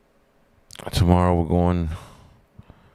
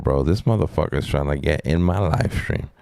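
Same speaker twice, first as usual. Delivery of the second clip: ordinary talk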